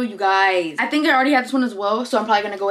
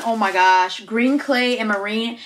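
normal talking voice

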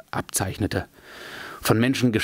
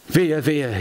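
higher, lamenting elf's cry; wailing lament; 5 semitones higher than usual. A narrator's reading. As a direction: wail it out in dismay. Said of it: "jammerte Legolas".

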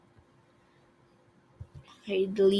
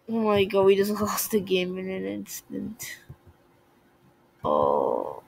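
usual speaking voice

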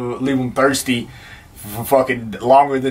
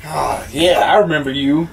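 ordinary talk

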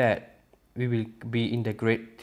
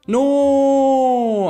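a sudden, much higher, louder cry of dismay; dismayed shout; 13 dB louder than usual. Real, not acted. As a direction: yell no as everything goes wrong. Loses items in the game.

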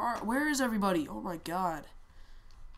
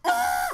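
sudden loud, very high shriek; short piercing yelp; high-pitched scream; 9 dB louder than usual. Real, not acted. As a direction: shriek out in the heat of the game. Startled outcry during game fight.

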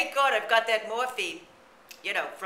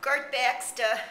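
put-on slow, higher old-lady purr of a greeting; breathy vintage purr; 7 semitones higher, slower than usual. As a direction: coo a slow hello to darlings. Character greets her audience.

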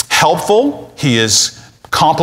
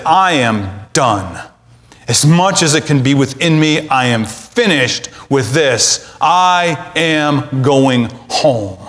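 slow, deliberate declaration; firm resolve; slower than usual. Shown as a demonstration. Preacher modelling the break for listeners, slow and firm. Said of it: "you need to emotively make the break and say".

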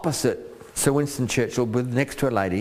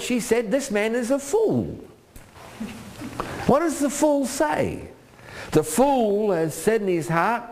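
high fervent preaching, slowed on key words; preaching at full force; 11 semitones higher, slower than usual. Acted. Preacher reading Abigail's plea, high fervent preaching. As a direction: ring it out to the church.

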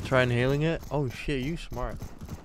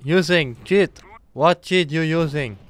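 mock broken English; 3 semitones higher, 8 dB louder, slower than usual. Mock broken English accusing cheater.

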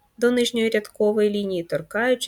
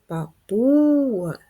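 Папуга with a long, louder, higher middle У; stressed У drawn out; 5 semitones higher, 5 dB louder, much slower than usual. Shown as a demonstration. Teacher showing pupils the stressed sound, Папуга with У stretched high and loud. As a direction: hold and lift the PU in Папуга. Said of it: "Який звук у нас наголошений?".

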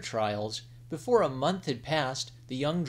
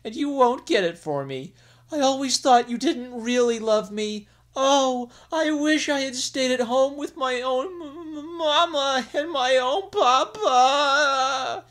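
high, louder tearful wail, slowing as it breaks; queen crying to her husband; choked sobs; 13 semitones higher, 8 dB louder than usual. Acted. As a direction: sob out the last words to mamma. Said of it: "her voice choked with sobs".

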